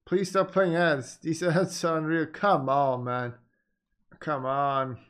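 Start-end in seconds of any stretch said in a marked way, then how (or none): none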